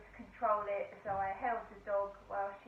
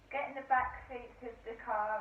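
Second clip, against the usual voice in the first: ordinary delivery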